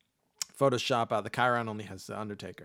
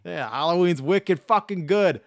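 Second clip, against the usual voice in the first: hammed-up Boston accent, louder; 5 semitones higher, 7 dB louder than usual. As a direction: bellow it like a Boston local. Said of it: "another Boston boy".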